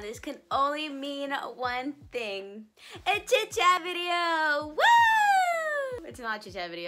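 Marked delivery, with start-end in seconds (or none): 3.1-6.1 loud, high, drawn-out mock cheer; over-the-top excited shout; high-energy announcement. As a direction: hype it up with a long, high whoop. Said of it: "That was a lot of energy".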